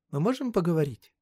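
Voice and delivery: put-on high, earnest girl's voice; serious girl. A narrator's reading. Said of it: "серьёзно сказала она". (серьёзный девичий голос)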